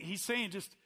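ordinary talk, nothing marked

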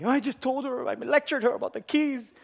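high, whining lament; anguished realisation. Retold or imitated. Husband who just lectured wife about keys, anguished lament. Dreading confession after lecturing her.

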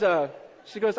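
ordinary talk

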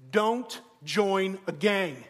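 very slow, weighty fatherly warning; solemn dad pronouncement. Acted. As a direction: draw out each word like momentous advice. Dad delivers his big second point.

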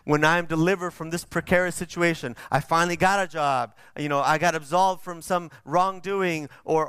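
ordinary talk, nothing marked

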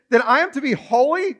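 usual speaking voice

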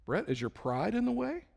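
high, soft leading question; God gently probing. Acted. God questioning the preacher, soft and probing. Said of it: "then I'll hear another question".

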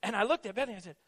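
normal talking voice

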